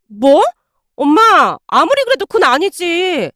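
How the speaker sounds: wife aghast at her mother's threat; shocked protest. A narrator's reading. (깜짝 놀라 항의하는 아내)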